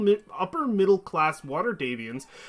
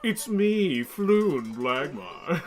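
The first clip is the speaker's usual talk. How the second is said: slow Floon voice; much slower than usual. Retold or imitated. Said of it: "the nasally voice for Floon".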